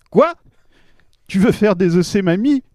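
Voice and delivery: outraged disbelief; shocked. Acted. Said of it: "Je vous le mets en scène".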